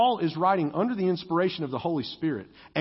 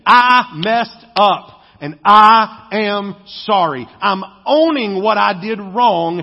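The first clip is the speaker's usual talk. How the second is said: sincere apology; 2 semitones higher, 9 dB louder, slower than usual. Shown as a demonstration.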